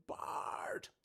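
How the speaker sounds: raspy creaky call of a name; Marge Simpson. Retold or imitated. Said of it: "the Marge Simpson".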